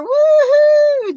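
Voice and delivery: high, loud woohoo; excited cheer. Real, not acted. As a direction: whoop for a friend who just arrived. Greeting a friend joining the stream.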